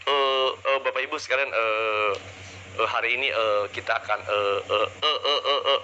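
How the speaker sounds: halting speaker padded with "eh"; low, hesitant demo full of filled gaps; filler-ridden hesitation. Shown as a demonstration. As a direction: stall on repeated "eh" as a bad example. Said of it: "Hindari atau kurangi gap".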